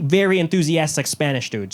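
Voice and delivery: ordinary talk, nothing marked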